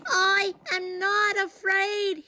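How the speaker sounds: high, slow shaky insistence; trembling shout. A narrator's reading. Trembling Frog insists to Toad he is unafraid, high. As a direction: shout bravely though trembling. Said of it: "he shouted".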